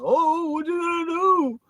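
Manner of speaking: high, loud squeaky taunting voice; mocking falsetto taunt. Mocking the rival he threw off the RV, squeaky high taunt. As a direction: mimic a whiny tough guy squealing.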